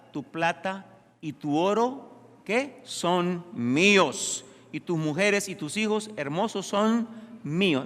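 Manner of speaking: slow, emphatic claim of ownership; stretched, insistent royal demand; lightly voiced demanding king. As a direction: lightly play a king laying claim, stretching key words. Reads enemy king's demand aloud.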